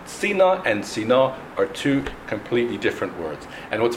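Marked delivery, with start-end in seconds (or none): 0.0-1.4 first Sina stressed on "Si", second Sina on "na"; contrasting syllable stress. Shown as a demonstration. Teacher separating two different words, Sina stressed first then last. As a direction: move the stress from start to end between Sina and Sina. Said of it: "where the emphasis on the word was".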